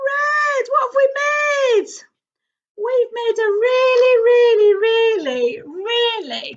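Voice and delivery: high, loud, thrilled exclamation; a burst of delight at the finished rainbow; excited shout. Real, not acted. Rainbow finale, big excited reveal.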